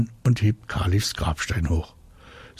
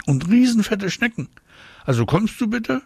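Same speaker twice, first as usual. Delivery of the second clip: put-on very high little girl voice; high, grossed-out child begging; squeaky pleading; 13 semitones higher than usual. A narrator's reading. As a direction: plead with Dad, pitched way up. Girl pleads with father in disgust.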